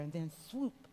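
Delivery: ordinary talk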